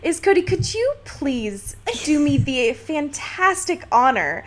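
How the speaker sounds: ordinary talk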